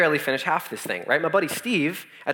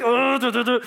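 higher babbling mock moan; speaking in tongues; 6 semitones higher than usual. Sick friend babbling skyward, higher mock tongues. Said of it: "like, speaking in tongues".

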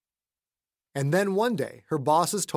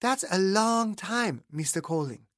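higher, slow, gentle Jean; a slow childlike higher Jean; Jean's voice; 5 semitones higher than usual. Acted. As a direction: ease him into it slowly. Jean's voice in story.

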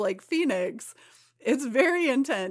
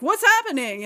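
high, loud bewildered cry; baffled X-Men; 8 semitones higher, 7 dB louder than usual. Retold or imitated. X-Men baffled by Kitty's tantrum, loud confused cry. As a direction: blurt it out in confusion.

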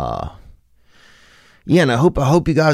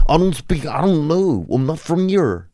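coronavirus as a sneaky foreign stranger; 7 semitones higher than usual. Pretend. Comedian voicing the coronavirus as a person, high shifty put-on voice. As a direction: play a shady guy pretending not to speak English. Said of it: "It would say shit like that".

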